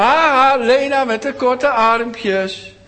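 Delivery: high, loud, slow jeering chant; mocking laugh. Bullies laughing at Lena's short arms, slow high jeer. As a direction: jeer at her like a bully. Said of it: "lachten ze haar uit en zeiden ze".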